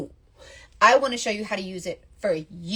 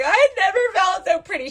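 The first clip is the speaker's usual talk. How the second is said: high, loud imitation of an overcome customer; retold tearful gushing; 9 semitones higher, 6 dB louder than usual. Grateful customer's video message, retold tearful gushing. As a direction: gush it high, as if moved to tears. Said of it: "she's sobbing and just tears".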